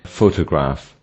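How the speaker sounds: "Photograph" with weight on PHO; PHO-to-graph, "Photograph" stressed at the start; stress on first syllable. Shown as a demonstration. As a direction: hit the opening syllable of "Photograph". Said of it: "stress on the first syllable".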